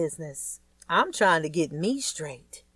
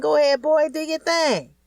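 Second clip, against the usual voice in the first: higher, louder, hype dad; proud encouragement; 10 semitones higher, 6 dB louder than usual. A narrator's reading. Fats urging his son to ride the bike, loud and proud. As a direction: cheer the boy on.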